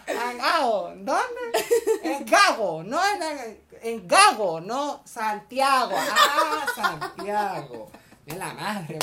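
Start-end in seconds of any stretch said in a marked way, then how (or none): 0.1-4.6 nombres gritados, agudos, riendo; gritos de malentendido. Pretend. 4.6-5.9 no Santiago muy lento y marcado; deletreado lento. Pretend. Corrige despacio. 5.9-7.9 nombres gritados, agudos, riendo; gritos de malentendido. Pretend.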